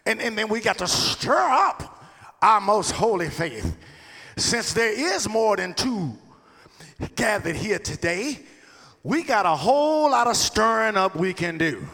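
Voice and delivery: fervent preacher's call; preaching. Acted. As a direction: preach it out to the church. Preaching at full force.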